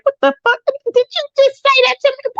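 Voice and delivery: high-pitched what-did-you-just-say disbelief; shocked disbelief. Retold or imitated. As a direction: act out your jaw dropping at what he said. Retold shock at a date's remark.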